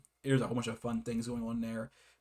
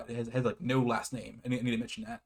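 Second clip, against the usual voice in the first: usual speaking voice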